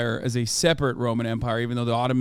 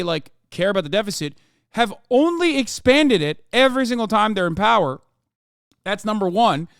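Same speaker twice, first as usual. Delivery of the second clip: heated rant; 7 semitones higher, 4 dB louder than usual. Real, not acted. Streamer ranting about shutdown.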